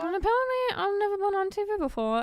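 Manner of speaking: high, loud nervous guest voice; hammed-up nervous guest. Louder imitation of interviewee.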